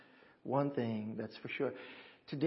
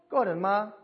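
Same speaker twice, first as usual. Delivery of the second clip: greeting demo; 6 semitones higher, 4 dB louder than usual. Shown as a demonstration. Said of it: "The first greeting is when you see my mom".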